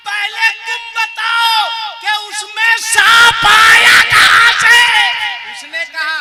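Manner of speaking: high, loud fiery sermon; full-force preaching. Acted. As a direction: preach it at the top of your voice. (जोशीला ऊँचा प्रवचन)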